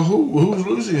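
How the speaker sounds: high, confused question; puzzled. Retold or imitated. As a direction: ask it baffled. His own puzzled question.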